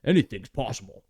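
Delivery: lightly done gruff Thing impression; a hint of the Thing, folksy. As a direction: play the Thing, hinted only. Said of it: "That's my thing voice".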